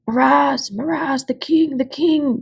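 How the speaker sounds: roaring war cheer; army roar. A narrator's reading. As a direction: bellow for your king like a soldier in the ranks. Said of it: "came the roar from the Telmarines".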